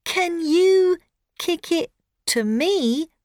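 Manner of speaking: put-on high, loud little girl; eager girl asking; young girl voice. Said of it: "says Rosie".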